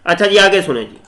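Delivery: ordinary delivery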